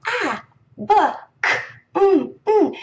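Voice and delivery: high, isolated first sounds; first sounds only. Shown as a demonstration. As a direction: clip each card to its first sound. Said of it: "really quickly saying".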